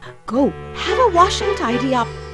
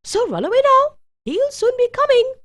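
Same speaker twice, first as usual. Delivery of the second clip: high-pitched, urgent warning; 9 semitones higher than usual. A narrator's reading. Tall woman warns Jack about her master, urgently. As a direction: shoo the boy off before danger comes.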